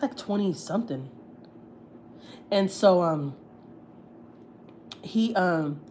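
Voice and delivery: ordinary talk, nothing marked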